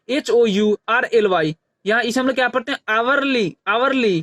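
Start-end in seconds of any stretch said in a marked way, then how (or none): 2.9-4.2 आवरली starting on the vowel आ, no H; teacher repeats आवरली, leaving the H unsounded; silent H. Shown as a demonstration. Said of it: "एच की ध्वनि नहीं निकालते". (आवरली को बिना ह की ध्वनि के दोहराते हुए)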